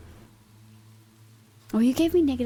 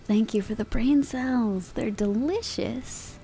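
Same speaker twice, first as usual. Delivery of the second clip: slow savoring; slower than usual. Acted. Savoring joke brain cells gift.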